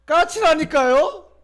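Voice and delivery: loud, slow exasperated reply; irritated son. Retold or imitated. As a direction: snap back at the father, drawn out.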